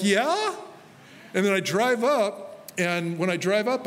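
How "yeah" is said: high, hammed-up yes; sheepish yeah. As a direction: squeak out a guilty yeah. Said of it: "And I'm like".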